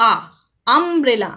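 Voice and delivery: আমব্রেলা with an open aa at the start; pronounced like aa. Shown as a demonstration. Teacher showing children আমব্রেলা starts with aa. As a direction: say আমব্রেলা starting with aa, not yoo. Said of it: "ইউর উচ্চারণটা কেমন করে হচ্ছে দেখো".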